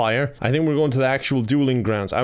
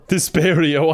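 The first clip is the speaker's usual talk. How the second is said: loud, punchy spell cast; mock spell shout; 4 semitones higher, 5 dB louder, slower than usual. Casting a spell at the teacher, called out loud. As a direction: call the spell out like a duelist.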